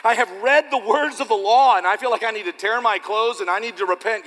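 anguished penitent king. Pretend. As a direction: cry out as a king who must repent. Voiced repentant king.

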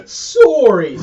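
a loud, teasing Canadian "Sorry"; mock Canadian sorry. Friend mocking how Canadians apologise, hammed-up Canadian accent. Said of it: "That's how you know someone's Canadian".

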